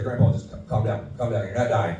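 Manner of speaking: quiet, soothing reassurance; soft calming. Retold or imitated. Grandson quietly calming his panicking grandpa. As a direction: settle him down gently.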